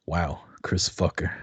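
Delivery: ordinary talk, nothing marked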